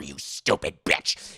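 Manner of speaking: low, breathy mock villain voice; hammed-up horror villain whisper; menacing killer rasp. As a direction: hiss it like a slasher.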